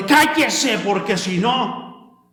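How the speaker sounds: mock authoritarian pastor silencing a member; imposing authority. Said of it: "para imponer la autoridad". (imitando a un pastor autoritario)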